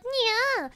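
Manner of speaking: high, whiny "nya" imitation; mocking cat meow. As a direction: mimic the cat to tease it. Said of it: "You sound like".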